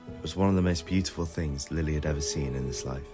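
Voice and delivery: ordinary delivery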